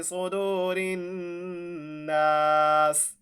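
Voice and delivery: सुदूरिन्नास ending on a stopped स, very slow; pause form. Shown as a demonstration. Teacher showing the pausal ending, very slow. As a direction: stop on सुदूरिन्नास and hold it. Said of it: "तो जब वक्फ करेंगे".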